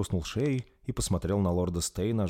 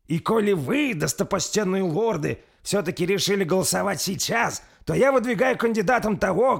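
higher, louder solemn lord addressing the council; proud lord's speech; 12 semitones higher, 6 dB louder than usual. A narrator's reading. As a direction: declare your candidate with solemn pride. Narrator voices lord Bersi. (торжественная речь лорда, выше и громче)

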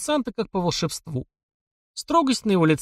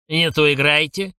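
mild shout. A narrator's reading. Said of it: "крикнул музыкантам".

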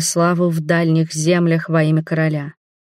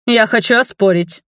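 blurted out, higher and louder; sudden protest, pitched up; blurting; 5 semitones higher, 4 dB louder than usual. A narrator's reading. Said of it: "выпалил Эскорт".

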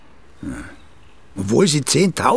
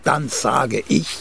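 ordinary talk, nothing marked

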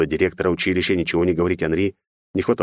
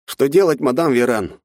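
urgent servant voice; rushed, worried question; flustered servant; 7 semitones higher, 4 dB louder than usual. A narrator's reading. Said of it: "вылетел откуда-то Жерар". (взволнованный слуга)

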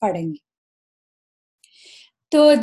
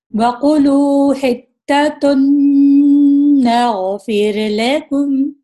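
louder chanted recitation, verse read in full; Quranic recitation; 3 semitones higher, 6 dB louder than usual. Shown as a demonstration. Teacher reciting the full verse to students, chanted. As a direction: recite it like a qari. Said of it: "تو اسے پڑھتے ہیں".

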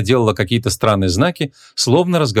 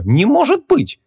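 high, amazed disbelief; astonished, rising pitch; genuine surprise; slower than usual. A narrator's reading. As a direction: exclaim that you can't believe it. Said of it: "всерьёз удивилась подружка".

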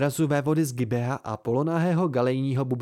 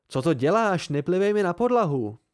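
louder and higher, indignant scolding; outraged roommate; angry rebuke; 6 semitones higher, 4 dB louder than usual. A narrator's reading. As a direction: snap at him for spitting on the floor. Simon scolds spitting friend.

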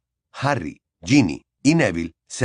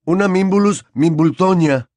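put-on proud, higher boyish voice; 8 semitones higher, 6 dB louder than usual. A narrator's reading. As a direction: announce it proudly, lifted and loud. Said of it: "dijo con orgullo".

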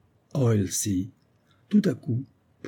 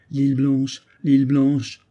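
repeated cry in a slightly raised voice; madman's obsessive refrain; 3 semitones higher, 3 dB louder, slower than usual. A narrator's reading. Deranged castaway endlessly repeating one name, obsessive cry. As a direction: chant it as a fixed obsession. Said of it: "ne cessaient de répéter".

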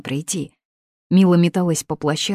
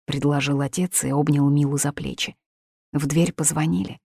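plain reading voice

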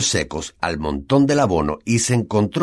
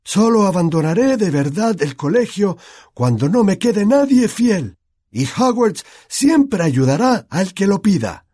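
Dumbledore's remembered words; 12 semitones higher, 5 dB louder than usual. A narrator's reading. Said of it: "las últimas palabras de Dumbledore".

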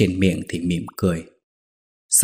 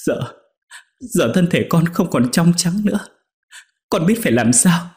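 high, faltering tearful pleading; mock weeping, voice pitched up; a maid fake-crying to her master, high and halting; tearful lament; 7 semitones higher than usual. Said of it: "Con Nhung khóc lóc nói".

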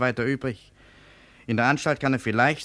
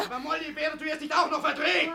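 high exasperated retort. Acted. Brother rebukes sister.

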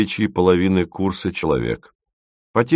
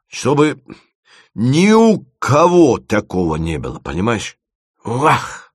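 frustrated, emphatic outburst, raised pitch; struggling to explain; 3 semitones higher than usual. A narrator's reading. Dima cannot explain the fabric he wants, frustrated. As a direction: lean hard and long on key words. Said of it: "Не в силах внятно объяснить".

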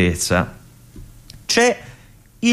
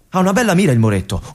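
ordinary talk

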